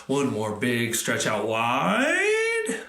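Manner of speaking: usual speaking voice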